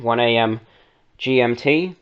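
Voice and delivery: ordinary talk, nothing marked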